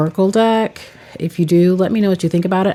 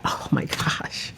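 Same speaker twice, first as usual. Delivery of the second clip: soft, slow, breathy whisper of dismay; whispered oh my gosh; 10 dB quieter, slower than usual. Real, not acted. Sees the cards stuck together, whispers in dismay. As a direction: whisper it to yourself, startled by the sticky cards.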